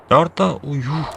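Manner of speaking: sudden high startled cry; surprised. Real, not acted. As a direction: caught off guard, blurt it out. Shot from unseen enemy.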